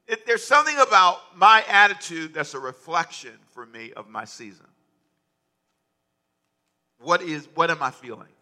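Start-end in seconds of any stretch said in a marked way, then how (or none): none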